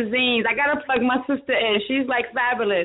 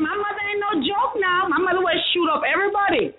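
pitched way up, excited retelling; retold outburst; 7 semitones higher than usual. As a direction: replay your own fired-up words high. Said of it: "I said".

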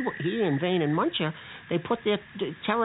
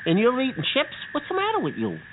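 exasperated; 7 semitones higher than usual. Acted. Bucky scolds Betty over chips.

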